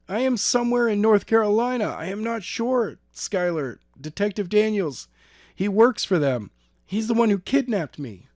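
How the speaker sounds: put-on high girl's voice, urgent and scared; frightened girl. A narrator's reading. Kidnapped girl telling Skylar on the phone, high and urgent.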